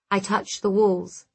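ordinary delivery

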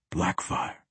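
breath-only whisper, very soft; hushed whispered summons. A narrator's reading. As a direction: whisper it under your breath. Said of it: "he whispered".

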